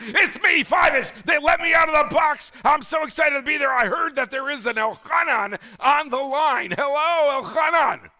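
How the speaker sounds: high, excited puppet voice; Five-ish. Acted. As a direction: squeal it out like a freed mascot. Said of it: "we go to our Five-ish".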